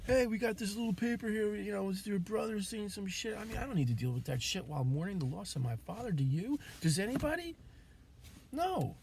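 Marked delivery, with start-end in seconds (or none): 0.1-3.4 mock cop voice. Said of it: "coming to my house". 6.1-8.9 high, loud challenge to the audience; heated rhetorical questions, higher and louder; indignant rhetorical shout. Real, not acted. Rhetorical questions, rising anger.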